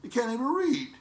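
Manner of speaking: put-on high, scolding kid voice; child mocking a father who fumbles reading, high exasperated whine. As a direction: play the child fed up with daddy. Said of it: "your child looking at you like".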